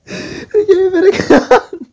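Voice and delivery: slow, high wheeze of laughter; real helpless laughter. Real, not acted. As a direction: squeeze the words out between laughs, loud. Still cracking up at the picture.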